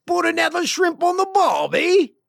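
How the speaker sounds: very high, loud mock-Australian shout; hammed-up Aussie accent. Host tacking on an Aussie cliché, shouted mock-Australian accent. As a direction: holler it in a badly faked Aussie accent. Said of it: "I can't do an Aussie accent".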